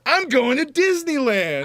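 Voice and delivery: high, slow goofy voice; drawn-out dopey answer; goofy mock Hunter.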